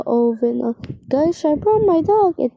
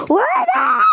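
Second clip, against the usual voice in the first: high cracking shout; 6 semitones higher than usual. Acted. Chef shouting across the kitchen. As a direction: yell it with everything. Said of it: "I cannot shout like that".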